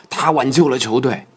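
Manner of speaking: excited quoted commentary, voice lifted; excited commentator. Retold or imitated. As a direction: re-enact the commentator's excited call. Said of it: "当时的解说激动地说".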